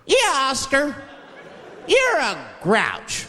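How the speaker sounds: put-on high kid's taunt; chorus of kids. Sesame Street kids taunting Oscar, high voice. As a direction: sing it out like a mocking child. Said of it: "kids on the show".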